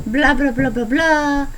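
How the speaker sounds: ordinary delivery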